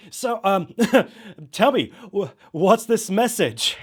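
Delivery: loud, high, laughing host; host recovering from laughter on air; suppressing laughter. Acted. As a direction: fight off a laugh, slow the question. Host holding back laughs.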